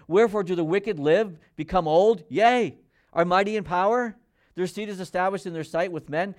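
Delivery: loud, emphatic Bible reading; scripture reading. Acted. Preacher reads Job to congregation, forceful scripture reading. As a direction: declaim the passage with rising force.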